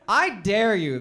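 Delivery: normal talking voice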